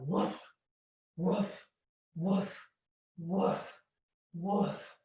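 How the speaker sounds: a row of soft woofs; playful dog sound effects for the kids. Shown as a demonstration. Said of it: "some sound effects to go with our dog".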